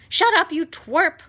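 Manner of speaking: loud, high, cutting snap; sharp snap. A narrator's reading. Aunt Spiker snapping at the boy, loud and high. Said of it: "Aunt Spiker snapped".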